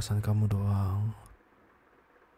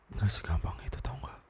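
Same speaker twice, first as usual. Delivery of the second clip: hurt whisper; 7 dB quieter than usual. Acted. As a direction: drop to a whisper, wounded. Hurt reply in a quarrel.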